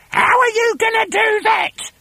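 very high put-on wife's screech; screechy Missus Twit. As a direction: screech the question at your husband.

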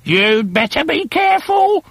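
put-on a shrill-pitched old wife nagging; hag wife. A narrator's reading. Wife needles her plotting husband, high hag voice. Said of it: "Missus Twit said".

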